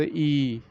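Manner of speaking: "ई" held as a long ee; long vowel sound. Shown as a demonstration. Teacher showing the letter's sound, "ई" held long. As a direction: stretch "ई" out evenly. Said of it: "इन आवाज़ों को".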